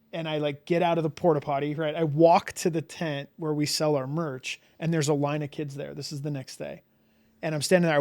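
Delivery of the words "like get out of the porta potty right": normal talking voice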